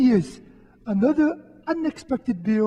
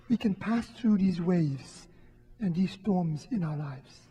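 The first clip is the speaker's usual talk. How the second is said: tender reassurance; 3 semitones lower, 5 dB quieter than usual. Retold or imitated. Jesus voiced comforting Sarah.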